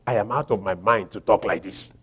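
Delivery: low, quiet aside after a pause; subdued admission. Acted. Preacher voicing Paul pausing in his boast, subdued. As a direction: drop the voice low and soft. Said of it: "he paused and said".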